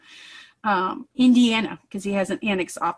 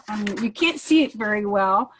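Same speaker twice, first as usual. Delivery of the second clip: ordinary talk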